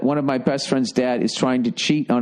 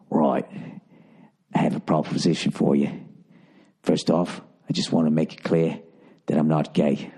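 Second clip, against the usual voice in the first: put-on deep, low old British man; bad British accent; 8 semitones lower than usual. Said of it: "I can't keep doing this accent".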